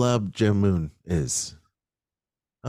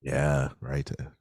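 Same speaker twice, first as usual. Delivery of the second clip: ordinary talk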